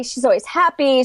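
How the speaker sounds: normal talking voice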